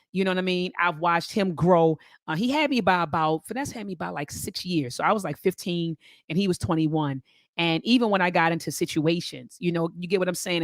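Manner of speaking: ordinary delivery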